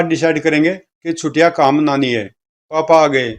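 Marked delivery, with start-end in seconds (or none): none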